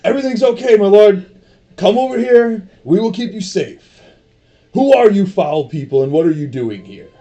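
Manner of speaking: big, high, loud bodyguard voice; Goliath guard voice. Acted. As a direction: boom it out like a giant guard. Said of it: "he's like".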